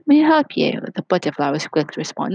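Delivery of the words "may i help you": high, casual offer of help; nonchalant. A narrator's reading. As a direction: toss it off like you barely care. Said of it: "he said nonchalantly".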